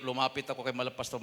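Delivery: usual speaking voice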